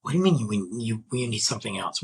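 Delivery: usual speaking voice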